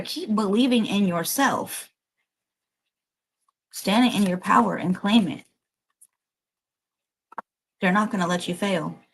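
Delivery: normal talking voice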